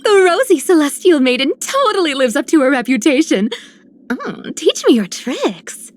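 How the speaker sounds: excited fan, swooping pitch; gushing admiration. Acted. Admirer begging the celestial maiden for tricks, gushing. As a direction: gush in awe, then plead eagerly.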